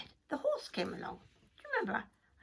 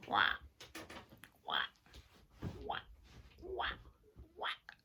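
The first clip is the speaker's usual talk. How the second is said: duck quack; 7 semitones higher, 7 dB quieter than usual. Acted.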